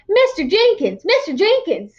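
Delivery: put-on high, eager child's call; calling out eagerly. A narrator's reading. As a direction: call out to him, excited. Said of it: "she cried".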